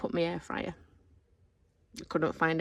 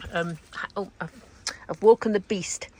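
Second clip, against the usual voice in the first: ordinary delivery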